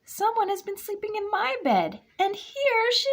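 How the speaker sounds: very high squeaky cub voice. A narrator's reading. Baby Bear finding Aluki in her bed, very high squeak. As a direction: squeak it like a tiny excited cub.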